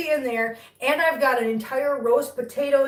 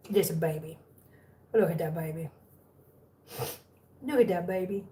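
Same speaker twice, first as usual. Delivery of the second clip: tender cooing; 5 semitones lower, 5 dB quieter than usual. Real, not acted. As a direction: dote quietly on a kitten. Cooing over sleepy kitten.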